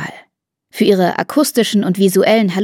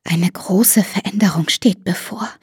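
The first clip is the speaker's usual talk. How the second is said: whisper. Acted. Mother whispering a prophecy.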